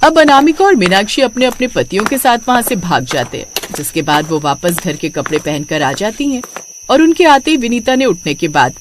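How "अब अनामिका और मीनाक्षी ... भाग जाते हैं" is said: ordinary delivery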